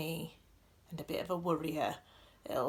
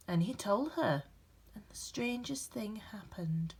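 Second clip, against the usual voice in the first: gentle, expressive storybook reading with dramatic pauses; reading to children; 3 dB louder than usual. A narrator's reading. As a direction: read it like a bedtime picture book for a class. Reads picture book aloud.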